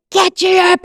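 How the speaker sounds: high, loud barked order; policeman's roar. Retold or imitated. Police officer yelling at a little girl, loud high shout. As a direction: roar it like an angry cop at a child. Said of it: "he fucking roared the head of me".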